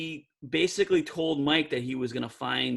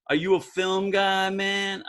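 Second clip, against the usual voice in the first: imitating a raised New York street drawl; lightly voiced New York guy asking the narrator a question; New York accent; 5 semitones higher, slower than usual. Director quizzing the narrator, a hint of New York street accent. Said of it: "he goes".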